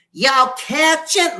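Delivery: very high, slow, loud squeal; loud squeaky shout. Retold or imitated. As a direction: belt it out in a squeaky voice. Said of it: "Like, really loud".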